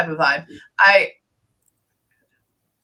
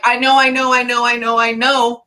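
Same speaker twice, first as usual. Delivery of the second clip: run-on repeated reply, slightly raised; insistent repetition; 4 semitones higher, 4 dB louder than usual. Retold or imitated. Medium relays departed friend answering the client, insistent repetition. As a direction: cut in over and over, acknowledging impatiently. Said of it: "Keep saying that over again".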